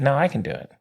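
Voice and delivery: ordinary talk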